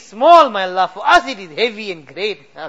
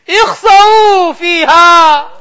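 stern command. Retold or imitated. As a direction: bark it like chasing away a dog. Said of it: "you will tell Malik, tell them".

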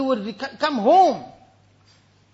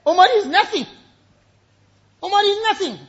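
higher, forceful quoted cry; raised preaching voice; emphatic self-abasement; 7 semitones higher, 6 dB louder than usual. Acted. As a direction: declare it like a humbled man's plea. Dying caliph's humbling cry, repeated.

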